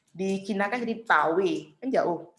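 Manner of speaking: usual speaking voice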